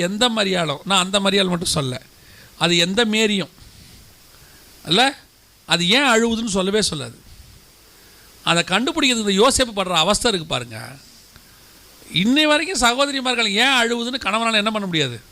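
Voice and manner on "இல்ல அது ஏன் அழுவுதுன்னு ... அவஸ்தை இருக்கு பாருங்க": usual speaking voice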